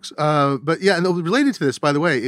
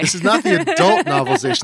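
mock solemn; 7 semitones higher, 4 dB louder than usual. Mock serious clarification.

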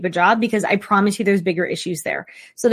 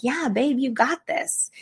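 encouraging partner; 5 semitones higher than usual. Retold or imitated. Partner cheering you on before a big try. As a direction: pump them up like a cheerleader. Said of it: "Do they say, like".

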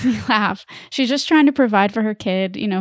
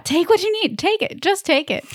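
high-pitched, pushy mom voice; insistent mom; 9 semitones higher than usual. Acted. Podcaster imagines herself as a mom, insistent mom voice. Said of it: "That's me as a mom".

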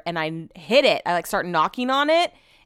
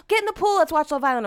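high-pitched mock partner voice; high, needling imitation; pestering partner; 8 semitones higher than usual. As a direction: imitate an eager invitation, pitched way up. Imitating partner's eager invitation.

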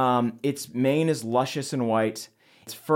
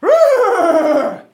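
high, loud beast roar; moonicorn roar; 12 dB louder than usual. Acted. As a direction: bellow it out like a huge horse. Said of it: "lets out a big, strong, like".

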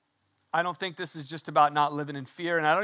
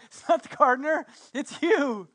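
excited realization; 8 semitones higher, 4 dB louder than usual. Acted. Mary realizes it's Jesus.

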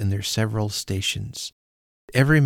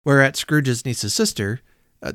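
usual speaking voice

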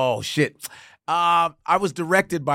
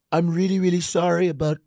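Derek Zoolander impression; 2 semitones higher than usual. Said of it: "Can you imitate me as Derek Zoolander".

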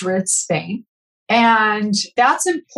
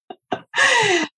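high-pitched laugh; high, self-aware burst of laughter; 14 semitones higher than usual. Real, not acted. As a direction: laugh at your own admission. Laughs at own admission about child.

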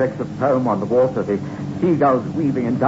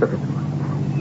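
hushed awe; 3 dB quieter, much slower than usual. Acted.

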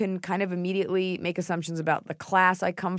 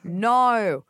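broad Australian NO, higher and louder; Australian accent; 4 semitones higher, 5 dB louder than usual. Shown as a demonstration. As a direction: stretch No into an Australian diphthong. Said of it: "saying no as an Australian".

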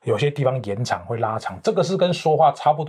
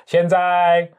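emcee pitch lift; 7 semitones higher, 6 dB louder than usual. Shown as a demonstration. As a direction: whip up the crowd like a stage host. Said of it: "那声音就拉起来了".

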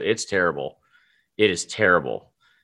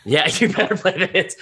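laughing; 6 semitones higher than usual. Real, not acted. Laughing echo of co-host joke.

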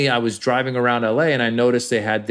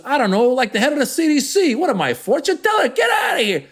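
much higher, shouted New York Italian dismissal; shouting Italian mob boss; 13 semitones higher than usual. Acted. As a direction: bark him off exasperated. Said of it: "doing the Italian guy".

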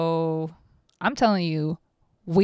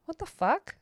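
incredulous outburst; 9 semitones higher than usual. Retold or imitated. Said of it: "I'm over here like".

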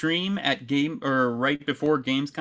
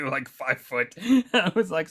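normal talking voice

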